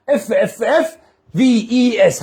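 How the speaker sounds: letters drawn out one at a time; slow spelling out. Shown as a demonstration. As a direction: spell it slowly for the class.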